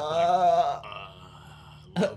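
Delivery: vocal fry impression. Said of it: "Roger Brown used to talk like that".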